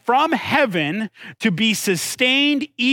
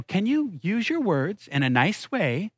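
softer, measured parental coaxing; patient parent; 5 dB quieter than usual. Retold or imitated. Parent answering whining kids about getting water, softly coaxing. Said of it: "I go".

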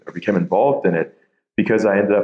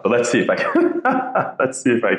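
laughing; 6 semitones higher than usual. Real, not acted. Guest laughs while starting answer.

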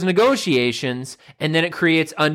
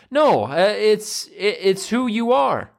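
ordinary delivery

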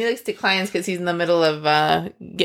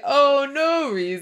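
slow, sly, laughing innocence; mock innocent; 6 semitones higher, slower than usual. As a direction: play dumb with a sly chuckle. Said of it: "He's like".

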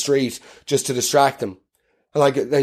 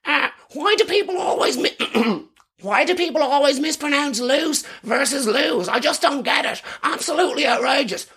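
much higher, fussy correcting voice; pedantic voice; 9 semitones higher than usual. Pretend. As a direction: nitpick from the ditch, prissy and indignant. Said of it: "this voice from the ditch".

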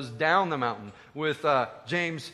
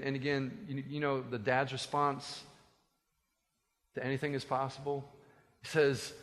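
normal talking voice

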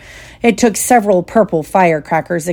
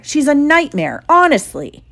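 Ron's scornful voice; 9 semitones higher than usual. Acted. Ron sneering about Hermione.

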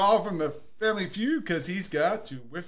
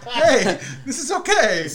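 laughing, higher and louder; 4 semitones higher, 5 dB louder than usual. Retold or imitated. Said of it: "the second sip was like".

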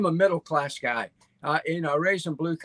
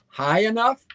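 "High enough?" asked higher and louder; stressed question; 5 semitones higher, 4 dB louder than usual. Shown as a demonstration. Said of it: "I'm emphasizing that".

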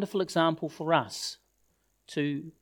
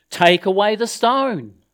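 louder, higher, drawn-out order; firm command; 4 semitones higher, 7 dB louder than usual. Acted. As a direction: call out a slow, loud command. Jesus commands at the tomb.

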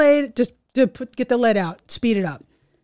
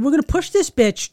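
higher, insistent retold order; 4 semitones higher than usual. Aunt telling balking niece to push, insistent. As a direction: push back at her reluctance.

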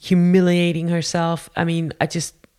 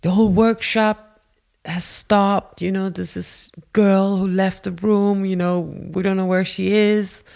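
higher-pitched urgent announcement; retold alarm; 4 semitones higher than usual. Woman reporting the missing girl, high mock alarm. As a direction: raise the pitch, report it as an emergency.

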